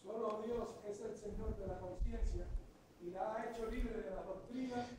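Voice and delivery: normal talking voice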